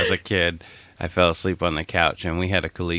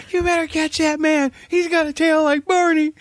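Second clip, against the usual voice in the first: put-on high, loud, dreamy child voice, much higher than usual; sleep-talking kid; 4 dB louder than usual. Kid talking in his sleep to the grown-ups, high and dreamy. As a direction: play a half-asleep little kid blurting nonsense.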